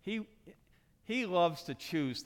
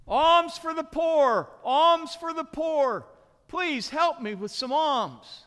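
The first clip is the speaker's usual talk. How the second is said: loud, high, drawn-out street call; pleading beggar shouting long vowels; beggar's cry; 12 semitones higher, 11 dB louder, slower than usual. Acted. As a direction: call out slowly for charity over the street. Blind beggar calling out, acted.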